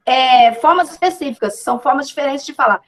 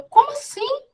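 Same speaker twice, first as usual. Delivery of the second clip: surprised reaction; 11 semitones higher, 3 dB quieter than usual. Retold or imitated. Her surprised reaction retold.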